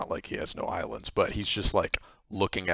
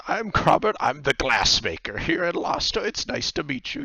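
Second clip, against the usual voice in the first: put-on high, louder old-man greeting; odd old man greeting; 7 semitones higher, 5 dB louder than usual. Grobert introduces himself to the three adventurers, high-pitched. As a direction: greet them brightly in a higher voice.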